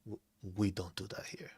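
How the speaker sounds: hushed, disapproving squirrel. Acted. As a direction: mutter it like a prim squirrel. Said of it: "the squirrels is like".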